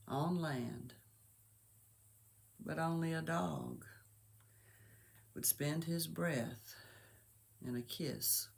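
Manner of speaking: slow, measured reading with long pauses; solemn, softly spoken storytelling verse, dropping low at line ends; poem recitation. Acted. Reciting a poem about a dying dog, hushed and grave.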